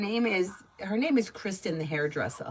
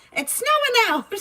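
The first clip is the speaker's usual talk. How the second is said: much higher and louder, a Staten Island mother-in-law announcing snow; imitating Italian mother-in-law; 7 dB louder than usual. Mimicking a sketch mother-in-law for a viewer, high loud alarm. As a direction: squeal it out like a dramatic Italian mom. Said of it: "But she does this".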